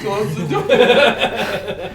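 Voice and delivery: laughing plea. Real, not acted. Panicked joke at the skeleton.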